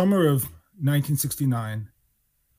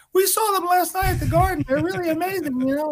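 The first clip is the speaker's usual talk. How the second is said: put-on much higher, eager kid voice; starstruck kid. Two teens tell the band manager they loved the show, high and eager. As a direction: gush like a starstruck teenager. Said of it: "And we're like".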